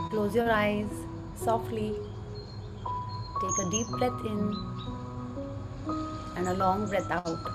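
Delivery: soft, slow breathing guide; right in your ear. Acted. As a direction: gently guide each breath, slowly. Leads a breathing meditation.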